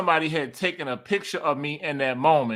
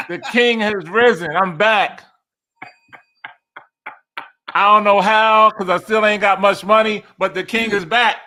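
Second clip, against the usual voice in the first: loud, high, comic proclamation; triumphant boasting; 6 semitones higher, 6 dB louder than usual. Retold or imitated. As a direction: crow about the comeback, playing for laughs. Comic triumphant comeback, listeners laughing.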